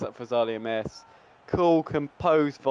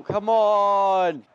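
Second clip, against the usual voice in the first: high, loud, drawn-out plea; will the shot in, loudly; urgent urging; 6 semitones higher, 5 dB louder than usual. Real, not acted. Gamer urging Vidal on, desperate and loud.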